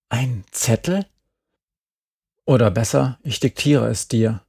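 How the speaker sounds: ordinary delivery, nothing marked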